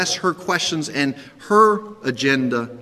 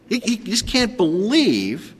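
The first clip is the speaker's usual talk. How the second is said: ordinary delivery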